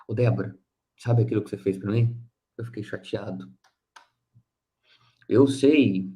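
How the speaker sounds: calm gentle talk. Acted. To Débora after the anger passed, calm gentle talk. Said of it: "Espera a raiva passar, aí eu busco a Débora".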